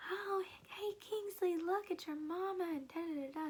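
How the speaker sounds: cheerful midwife voice. Retold or imitated. Midwives greeting the newborn Kingsley, high sing-song coo.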